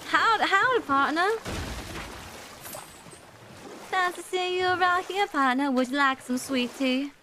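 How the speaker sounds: high, hammed-up Southern accent; Southern horse girl. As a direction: play a chirpy-pitched Southern cowgirl. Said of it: "I am a Southern horse girl".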